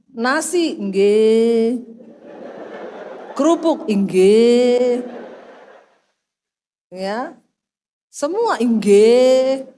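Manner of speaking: long, drawn-out low "nggih"; mock-servile, stretching each yes; obedient wife imitation. Mother serving father like a king, exaggerated compliance. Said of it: "Melayani papa tuh kayak raja".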